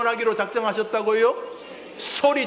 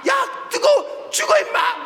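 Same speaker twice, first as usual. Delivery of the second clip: much higher, alarmed yell; urgent warning shout; 4 dB louder, slower than usual. Acted. Preacher acting out a warning to someone near scalding water, urgent high shout. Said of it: "소리 지를 거 아니에요".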